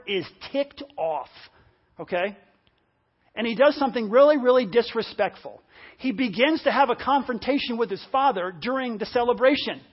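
usual speaking voice

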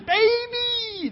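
high, drawn-out mock squeal; excited baby craze. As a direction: squeal it in a long, high, held note. Mimics people clicking at newborn smell.

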